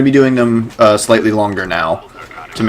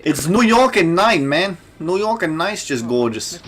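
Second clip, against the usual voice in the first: a deliberately bad, hammed-up New York accent pitched higher; terrible New York accent; 7 semitones higher, 4 dB quieter than usual. Gamer hamming up New York accent for his co-host, mock New York accent. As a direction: lay on a cheesy New York accent for laughs. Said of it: "That's a terrible New York accent".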